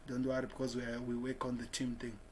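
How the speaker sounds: normal talking voice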